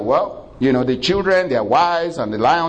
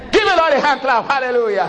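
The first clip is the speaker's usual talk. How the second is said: exhorting; 8 semitones higher than usual. Real, not acted. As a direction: rouse the congregation. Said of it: "preacher calls for applause".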